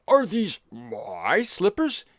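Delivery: slow, pompous king, stretching every MY; Nebuchadnezzar. Acted. King to wife about slippers and kingdom, pompous. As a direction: draw it out grandly with swooping questions. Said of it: "when his wife, uh, brought his slippers".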